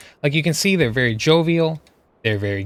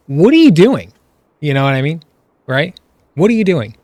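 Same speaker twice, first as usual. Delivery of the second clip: real frustration, much louder and higher; exasperated outburst; 4 semitones higher, 9 dB louder than usual. Real, not acted. Streamer yells at a teammate wasting his clears, real exasperated shout. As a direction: blow up at the screen in disbelief.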